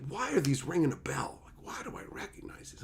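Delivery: whispered wondering to himself; soft puzzled whisper, recognizing the names; quiet puzzled. Retold or imitated. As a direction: hush it like thinking aloud. Puzzling over list of usernames.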